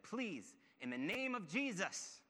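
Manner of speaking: high, drawn-out imitated plea; loud pleading. Wife begging the fleeing robber, imitated loud plea. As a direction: plead out slowly, voice pitched right up. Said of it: "in this really loud, sort of pleading voice".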